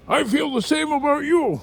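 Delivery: high, loud put-on voice; loud, high mock impersonation; mock voice of another man. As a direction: imitate him badly in a high, loud voice. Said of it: "he doesn't have that voice".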